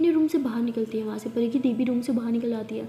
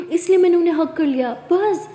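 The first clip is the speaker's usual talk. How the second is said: plain reading voice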